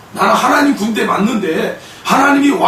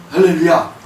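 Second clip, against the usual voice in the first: ordinary delivery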